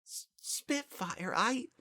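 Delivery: soft, slow, high stammer on the verge of tears; tearful. A narrator's reading. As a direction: choke back the tears. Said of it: "Tears welled up".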